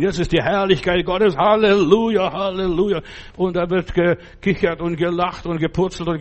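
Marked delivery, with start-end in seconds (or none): 0.0-3.0 hammed-up high, loud Halleluja; mock ecstatic praise. Preacher mocking churchgoers who get carried away, hammed-up ecstatic. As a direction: imitate overexcited worshippers shouting praise. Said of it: "Manche flippen aus".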